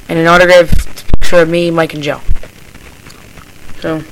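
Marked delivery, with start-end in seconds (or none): none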